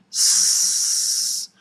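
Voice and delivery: long voiceless sss hiss; snake hiss. Shown as a demonstration. Teacher showing learners the snake sound, hissing. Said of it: "is it a snake?".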